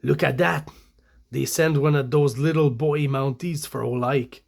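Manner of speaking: hammed-up French Canadian English; French Canadian accent. Kidnapper remarking on the Mounties sent for him, French Canadian accent. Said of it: "with a French Canadian accent".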